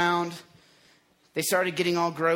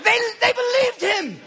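high, loud cry of amazement; shouted, amused disbelief; incredulous; 7 dB louder than usual. Real, not acted. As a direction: exclaim it as if you can't believe they fell for it. Exclaims in mock disbelief.

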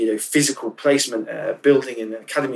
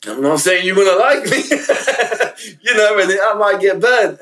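laughing; 5 semitones higher than usual. Real, not acted. Laughs while saying he gets burnt.

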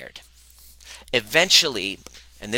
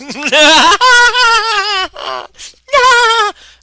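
high, loud uncontrollable cackle bordering on a cry; manic laugh; 15 dB louder than usual. Retold or imitated. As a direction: burst into a wild, helpless laugh. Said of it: "and then he's like".

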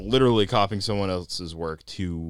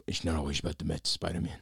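lightly voiced menacing crime boss; calm menacing drug lord; 2 semitones lower, 6 dB quieter, faster than usual. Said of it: "the Kingpin voice".

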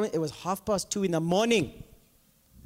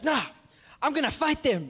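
defiant sister; 6 semitones higher than usual. Retold or imitated. Sister deciding to fight.

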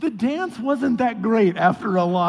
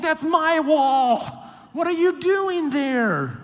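much higher, louder, slow outraged yell; indignant shout; 10 semitones higher, 5 dB louder, much slower than usual. Retold or imitated. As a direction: bellow at them from the wall. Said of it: "It's like".

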